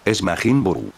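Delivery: ordinary delivery, nothing marked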